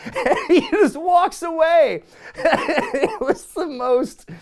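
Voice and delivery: slow, high words through real laughter; laughing. Real, not acted. Host retelling Jericho walking away, cracking up. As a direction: laugh helplessly while telling it.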